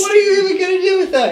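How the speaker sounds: high fast laughing yell; laughing shout. Acted. Acted skit, leg thief.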